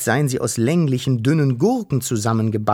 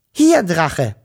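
higher, louder happy call; 5 semitones higher, 5 dB louder than usual. A narrator's reading. Wischel greets the dragon, calling out brightly. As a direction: call across to a friend. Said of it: "rief sie".

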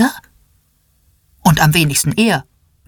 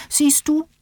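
calling out; 11 semitones higher, 4 dB quieter than usual. A narrator's reading. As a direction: cut in high, as a child calls out. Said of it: "ruft Niklas".

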